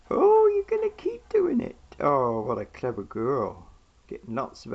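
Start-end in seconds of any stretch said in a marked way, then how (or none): 0.1-1.8 squeaky praising a dog. Real, not acted. Excited praise of pet. 2.0-3.7 slow praising. Real, not acted. Praising pet.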